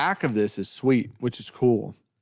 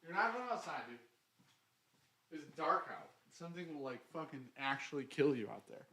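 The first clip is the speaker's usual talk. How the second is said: soft aside to pet; 19 dB quieter than usual. Real, not acted. Talking softly to his dog.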